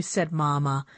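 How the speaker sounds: usual speaking voice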